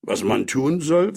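usual speaking voice

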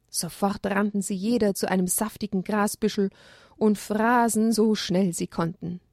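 ordinary delivery

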